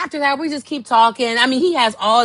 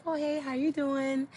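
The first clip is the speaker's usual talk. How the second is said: soft sweet hello; 5 semitones higher, 11 dB quieter than usual. Retold or imitated. Greeting in the hookah spot.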